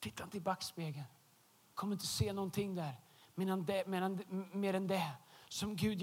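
quiet sermon plea to the congregation; soft earnest. Real, not acted. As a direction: drop the voice to a hush. Sermon point, dropped voice.

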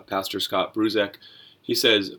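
ordinary talk, nothing marked

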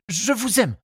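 high, nervous suitor's voice; blurted love confession. A narrator's reading. Charles finally confesses his love, blurting it. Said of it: "finit-il par dire".

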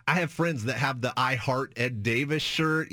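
ordinary talk

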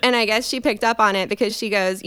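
normal talking voice

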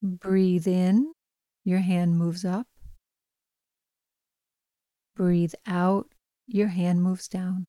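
calm, even bedtime meditation voice; guided meditation. Acted. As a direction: gently guide a listener toward sleep. Leads a bedtime breathing meditation.

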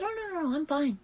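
high-pitched mock-cheerful insisting; exaggerated fake-fine voice; brushing it off. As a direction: overdo being fine, way up high. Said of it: "I just brushed it off and said".

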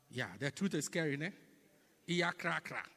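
usual speaking voice

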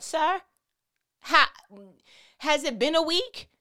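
pushing back in mock disbelief; incredulous objection. Acting Ananias doubting the command.

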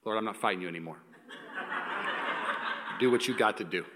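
low, soft surrendering prayer; quiet surrender prayer. Shown as a demonstration. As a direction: pray it softly, giving in. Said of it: "Here's how I roll now".

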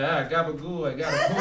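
playful hammed-up Italian accent; goofy Italian accent, half-shouted; mock Italian accent. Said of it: "It's like".

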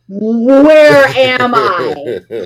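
put-on confused girl wondering where she is; bewildered. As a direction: drag it out, totally lost. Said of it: "And I'm like".

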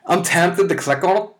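eager high squeal of temptation; almost giddy at the link; tempted, high and loud. Real, not acted. Comic reading a link aloud, giddy and high. Excited about clicking a link.

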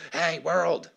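hammed-up shout trailing into rah-rah noise; mock shouting. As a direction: imitate a church yelling at the world. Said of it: "just shouting across to the world".

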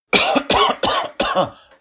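a loud high coughing fit; real cough. Real, not acted. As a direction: cough hard, caught off guard.

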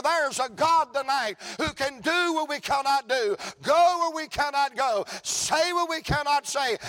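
high, loud, rhythmic revival shouting; impassioned preaching. Real, not acted. Preacher promising congregation their children's salvation, impassioned full-force preaching. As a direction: drive each phrase home at full cry.

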